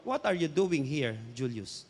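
low, soft, searching question; quiet divine question. Retold or imitated. Preacher retells God questioning him at his lowest, quiet and low. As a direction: voice God gently confronting someone, hushed.

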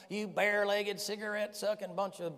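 lightly hammed-up insult hurled at passersby; mock ranting street preacher. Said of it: "screaming out on some kind of crazy".

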